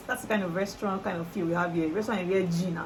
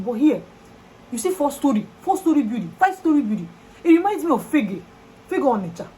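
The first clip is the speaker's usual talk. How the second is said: exasperated rant; 6 semitones higher, 7 dB louder than usual. Real, not acted. Exasperated rant about tall buildings.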